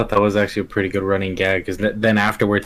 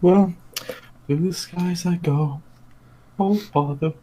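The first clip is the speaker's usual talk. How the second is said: higher, light Pooh voice; Pooh impression; 7 semitones higher than usual. Friend imitating Pooh flying through the air, higher. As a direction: float it up like Pooh. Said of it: "quoting Pooh soaring".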